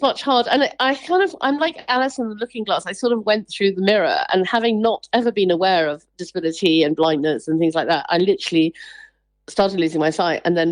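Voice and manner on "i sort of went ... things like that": ordinary delivery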